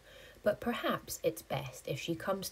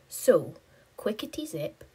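ordinary delivery, nothing marked

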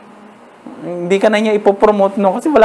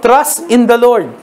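slow, raised verse reading; scripture reading; 5 semitones higher, slower than usual. Acted. Pastor reading the verse aloud to the church. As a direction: lift the verse up slowly.

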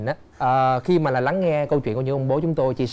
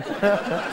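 high laugh; 4 semitones higher than usual. Real, not acted. Host laughs at mother-in-law.

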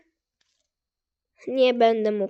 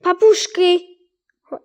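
higher, louder call to the parrots; 6 semitones higher, 7 dB louder than usual. Real, not acted. Gamer calling his parrots to follow.

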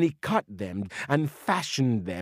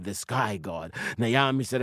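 ordinary delivery, nothing marked

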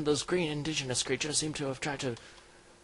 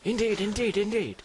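loud, high, rapid-fire gentlemanly assent; hammed-up alarmed gentleman; flustered agreement; 8 semitones higher, 7 dB louder than usual. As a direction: agree emphatically, all in a fluster. Agreeing after a creature attack.

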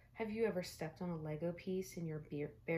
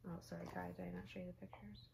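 ordinary delivery

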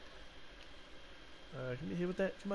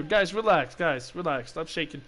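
usual speaking voice

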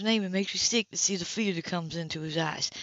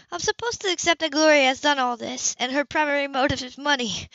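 a hint of shaken Sybil; 7 semitones higher, 5 dB louder than usual. A narrator's reading. Sybil voiced higher.

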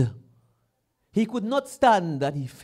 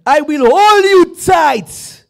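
God's promise shouted high and loud, slowly; the preacher roars God's promise to the congregation; booming shout; 9 semitones higher, 13 dB louder, slower than usual. Acted. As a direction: thunder it out as the voice of God.

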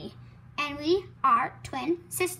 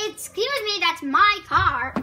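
indignant; 4 semitones higher, 8 dB louder than usual. Pretend. Doll objects to another using car.

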